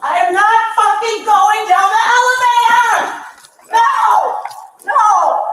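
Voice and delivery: high, very loud screaming protest; shouted refusal. Real, not acted. Detained woman refuses to go down the elevator, screaming.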